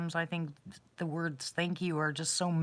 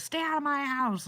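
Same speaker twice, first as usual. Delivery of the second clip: mild mock yell, pitched up high; retold yell; 8 semitones higher than usual. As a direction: imitate a man yelling, without real volume. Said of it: "yells at them all the time".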